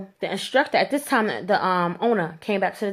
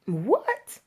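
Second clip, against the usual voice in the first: ordinary talk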